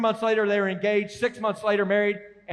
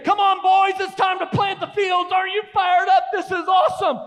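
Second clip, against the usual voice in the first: higher, louder, fired-up wake-up call; imitating excited dad; 8 semitones higher, 4 dB louder than usual. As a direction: shout like an overexcited father at dawn. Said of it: "wake us up at four A M and say".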